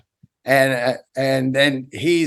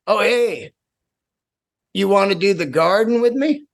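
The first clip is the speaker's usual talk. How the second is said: nonchalant; 4 semitones higher than usual. Retold or imitated. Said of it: "nonchalantly goes".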